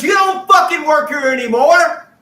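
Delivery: high, loud yelled firing; retold furious yell; furious shout. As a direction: bellow it at him like she's livid. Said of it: "She goes".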